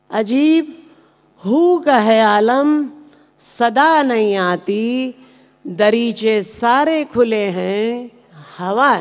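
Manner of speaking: drawn-out phrase endings with rising and falling lilt; ghazal recitation. Acted. Poet reciting a requested ghazal to the audience, measured lilting recitation.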